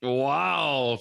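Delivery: ordinary delivery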